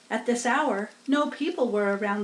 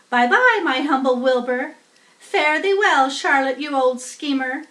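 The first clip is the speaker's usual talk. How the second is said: much higher, louder taunting goodbye; mocking farewell; 4 semitones higher, 7 dB louder than usual. A narrator's reading. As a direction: wave off the others with a sneer.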